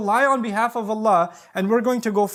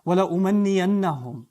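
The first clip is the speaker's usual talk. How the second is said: Quran recitation; much faster than usual. Shown as a demonstration. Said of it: "verse recitation".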